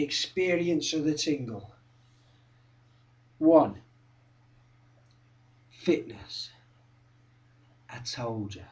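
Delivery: low, soft, spaced out; hushed, knowing reminder; quiet recap. Acted. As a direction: tick it off in an undertone.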